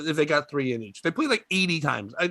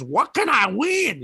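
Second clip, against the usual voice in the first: put-on old lady voice; 6 semitones higher, slower than usual. Old lady with one tooth.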